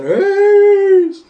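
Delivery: very high, loud, drawn-out squeal; attention-getting shout. Retold or imitated. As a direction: squeal a greeting to stand out. Acts out attention-getting entrance at photo.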